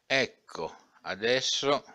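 put-on young man eager to prove his point, excited and loud; eager outburst. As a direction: burst in as if grabbing the magazine. Young man rushing to show proof.

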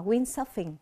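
"windsurfing" with the weight on WIND; stress on first syllable. Shown as a demonstration. Teacher showing students the stress pattern, "windsurfing" stressed on WIND. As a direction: lean on the opening syllable of "windsurfing". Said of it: "âm thứ nhất".

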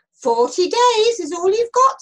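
high, loud proclamation; stern warning. A narrator's reading. Jonah warning the people of Nineveh, proclaiming. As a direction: warn the whole crowd at full voice. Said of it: "he warned them".

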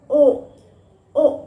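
ओ held long; ओ stretched out long; long oa sound. Shown as a demonstration. Teacher showing how long ओ should be. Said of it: "ओ लंबा है".

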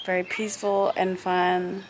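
sad. Real, not acted. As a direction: sound sad it's ending. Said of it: "I'm really sad".

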